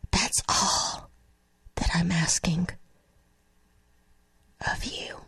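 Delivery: fading into a breathy whisper. Real, not acted. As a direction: let the prayer die away to a whisper.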